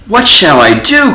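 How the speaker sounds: high, anxious mouse voice; fretting little mouse; worried cry. A narrator's reading. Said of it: "cried the mouse".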